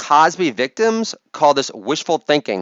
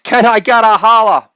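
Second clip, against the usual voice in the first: loud, higher-pitched shout to the crowd; calling out; 5 semitones higher, 5 dB louder than usual. Pretend. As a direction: holler it out like a hype man. Catchphrase call to the crowd.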